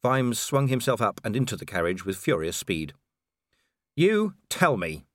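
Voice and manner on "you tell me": growled. A narrator's reading. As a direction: growl it slowly through anger. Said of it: "he growled".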